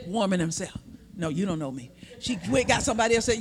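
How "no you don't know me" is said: low, quiet gruff denial; lightly voiced Peter muttering low; Peter's low denial. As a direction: drop the voice low and hush the denial.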